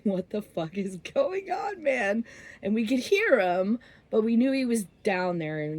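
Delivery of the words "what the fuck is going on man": mock scream climbing at the end; imitated screaming. Friend hallucinating in the swamp, mock scream. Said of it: "he just kept screaming".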